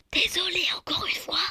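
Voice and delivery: soft apologetic whisper. Real, not acted. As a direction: breathe the apology.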